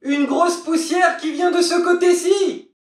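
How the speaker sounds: very high, excited call; sister on lookout. Acted. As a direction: announce a sighting from afar. Sister calls out a sighting.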